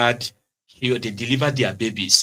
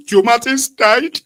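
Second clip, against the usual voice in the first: much higher, louder, slow whine; mock mourner; 11 semitones higher, 6 dB louder, slower than usual. Quoting the grieving friend, hammed-up high wail. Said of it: "He said".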